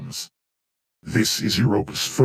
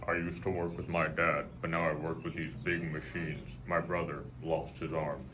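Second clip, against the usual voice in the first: quiet, worn-out coal-lung voice; hoarse child worker voice; soot-damaged voice; 5 semitones lower, 16 dB quieter than usual. Pretend. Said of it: "My voice is like this because of all the coal".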